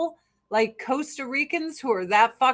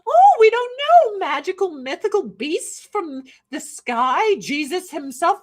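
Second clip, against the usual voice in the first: mock wonder; 7 semitones higher, 4 dB louder than usual. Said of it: "So I answered the fucking question".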